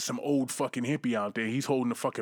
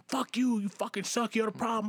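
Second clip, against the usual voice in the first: high mock yelling at cops; imitating an angry protester's yell; pitched-up mock shout; retold yelling; 7 semitones higher than usual. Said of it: "he's fucking yelling at these cops".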